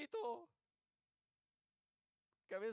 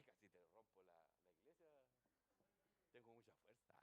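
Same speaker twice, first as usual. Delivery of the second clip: very soft, low aside; muttered mock threat; 11 semitones lower, 32 dB quieter, much faster than usual. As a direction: threaten under your breath, playfully. Amigo afuera amenaza golpeando. (amenaza imitada, en voz muy baja)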